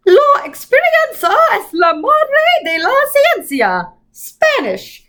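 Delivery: high, slow, quavering Spanish quote; Mrs Who voice. A narrator's reading. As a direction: lift it high and slow, quaint.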